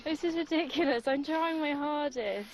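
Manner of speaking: upset, voice rising high and stretching into a wail; tearful frustration. Real, not acted. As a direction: protest through real tears. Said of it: "[name], niye ağladın?".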